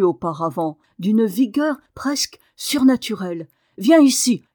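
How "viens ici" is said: loud commanding call; cri impérieux. A narrator's reading. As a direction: call him over urgently, raised voice. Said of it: "cria-t-il à Dick". (appel fort et impérieux)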